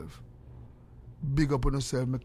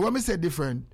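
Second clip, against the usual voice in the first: ordinary delivery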